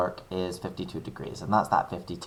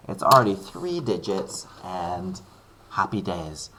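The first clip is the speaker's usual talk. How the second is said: normal talking voice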